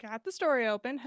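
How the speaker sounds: ordinary delivery, nothing marked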